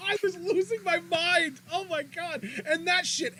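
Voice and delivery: riding the hype of the arena; thrilled. Real, not acted. Telling cohost about the crowd, thrilled.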